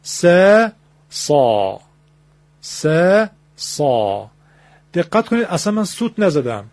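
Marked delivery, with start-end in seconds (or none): 1.1-1.8 سا deep and heavy from the throat; emphatic saa. Shown as a demonstration. Teacher demonstrating emphatic ص to listeners. 3.6-4.3 سا deep and heavy from the throat; emphatic saa. Shown as a demonstration. Teacher demonstrating emphatic ص to listeners.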